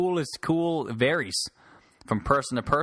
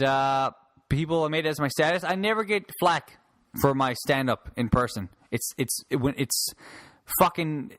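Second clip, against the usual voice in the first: ordinary delivery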